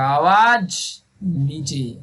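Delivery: very slow, low, drawn-out filmi line; Akshay Kumar dialogue. Retold or imitated. Teacher quotes Akshay Kumar's film line, slow and low. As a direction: drop the voice and stretch it like a movie hero. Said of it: "Akshay Kumar का dialogue याद आएगा".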